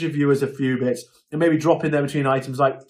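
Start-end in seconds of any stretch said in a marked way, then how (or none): none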